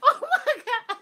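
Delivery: high, loud, helpless laughter through the words; cracking up. Real, not acted. Speaker cracked up by her own mom story, laughing hard. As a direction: barely get the words out for laughing. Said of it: "This stuff cracks me up".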